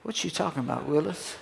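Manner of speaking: soft Gary Coleman catchphrase under your breath; inner thought. Acted. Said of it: "on the inside I'm thinking".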